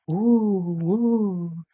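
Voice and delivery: long swooping "woo" like wind; airy wind howl, rising and falling. A narrator's reading. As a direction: imitate the wind in drawn-out hoots. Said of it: "the wind blew in the trees".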